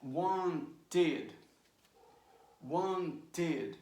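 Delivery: "wanted," split as wan-TED with a clear T; two syllables. Shown as a demonstration. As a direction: sound out both syllables of "wanted,". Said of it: "But the pronunciation is".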